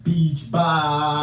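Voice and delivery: tongue pinched, loud and laughing; holding tongue. Shown as a demonstration. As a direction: yell it with your tongue held. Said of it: "hold your tongue and say".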